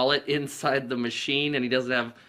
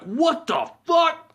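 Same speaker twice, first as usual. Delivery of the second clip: much higher, slow, stretched outraged cry; angry trucker; 6 dB louder, much slower than usual. Retold or imitated. Trucker hit by salt shaker, drawn-out outraged yell. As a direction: bellow it long and stunned.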